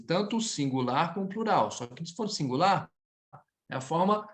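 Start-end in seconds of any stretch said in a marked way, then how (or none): none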